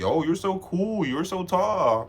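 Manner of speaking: high, slow gushing; gushing admirer. Retold or imitated. A lightly voiced fan praising him slowly and highly. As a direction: drag out the compliments like a smitten fan. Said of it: "He's like".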